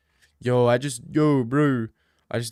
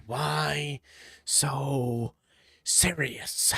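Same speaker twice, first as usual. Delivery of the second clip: joker whisper; 7 dB quieter, much slower than usual. Acted.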